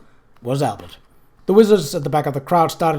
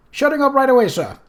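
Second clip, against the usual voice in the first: hasty cowed reply; 10 semitones higher, 4 dB louder, faster than usual. A narrator's reading. Rincewind cowed.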